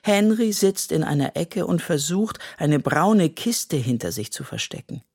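plain reading voice

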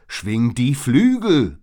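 slow, very loud roar; bellowed. A narrator's reading. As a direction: bellow the order with full force. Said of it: "brüllte ich noch lauter". (aus voller Kehle gebrüllt)

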